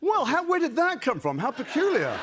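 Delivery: high, hammed-up astonishment; mock surprise. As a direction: feign amazement at the planted prop. Mock surprise at a planted map.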